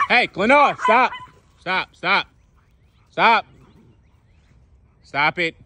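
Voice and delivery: loud, high call to stop; sharp loud commands at a misbehaving dog; real shout at the dog; scolding the dog. Real, not acted. Shouting at his dog mid-video.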